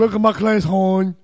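loud, hammed-up Baltimore teacher; mock Baltimore accent. Showing how the teacher greets the class, mock Baltimore accent. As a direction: have another go at a thick Baltimore accent. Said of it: "You can't even do a Baltimore accent".

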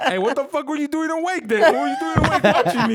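high, loud comic protest through laughter; mock indignant outburst. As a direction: retell it as an incredulous, laughing yell. Retold indignant comeback to mom.

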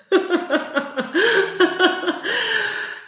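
high laugh. Real, not acted. Amused by learner's answer.

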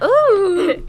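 high cracking ooh; cracky voice. Real, not acted. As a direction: voice cracks upward. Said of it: "my voice is so cracky today".

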